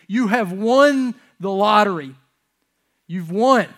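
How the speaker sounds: ringing announcement of the win; proclaiming loudly. Real, not acted. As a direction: announce it loud to the church. Preacher proclaims good news loudly.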